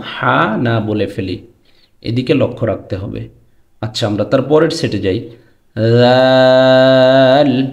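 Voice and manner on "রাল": long loud drawn-out রাল; Arabic letter sound. Shown as a demonstration. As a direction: hold রাল long as in recitation. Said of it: "রাল এবং জ".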